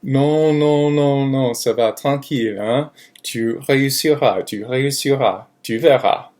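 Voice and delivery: reassuring a discouraged friend. Shown as a demonstration. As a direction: comfort them brightly, insist they'll make it. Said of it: "you can reassure them".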